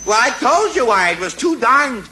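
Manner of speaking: irritated retort. Acted. Husband blamed for dropped glass, irritated. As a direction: snap back at the blame.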